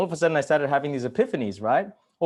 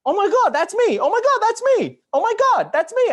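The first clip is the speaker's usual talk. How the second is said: very high, loud mock astonishment; excited epiphany; 8 dB louder than usual. Telling men how they'll react to the book, high excited exclaim. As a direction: shriek it as if struck by recognition. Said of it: "you will go".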